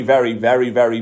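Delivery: normal talking voice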